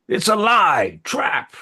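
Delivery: high, loud accusing cries; crying out. Acted. Court reacting to the envoy's offer, crying out. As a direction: cry out in alarm from the crowd. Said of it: "there are cries of".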